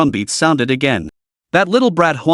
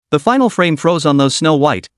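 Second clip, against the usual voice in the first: ordinary delivery, nothing marked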